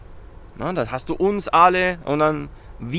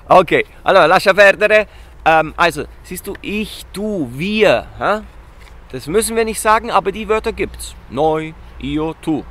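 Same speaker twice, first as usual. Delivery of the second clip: ordinary talk, nothing marked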